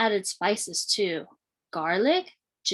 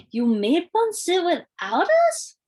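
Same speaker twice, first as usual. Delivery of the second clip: high, loud indignant call; hollered; 9 semitones higher, 4 dB louder than usual. A narrator's reading. Brother Crispin hollering at the table, indignant. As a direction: holler it in disbelief. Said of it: "hollered Cora's brother Crispin".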